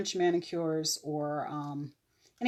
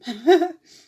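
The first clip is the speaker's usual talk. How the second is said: a sudden loud high laugh; burst of laughter; 12 semitones higher, 16 dB louder than usual. Real, not acted. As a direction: crack up at your own joke. Laughs at own pun.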